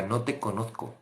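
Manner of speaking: stern Jesus rejecting. Acted.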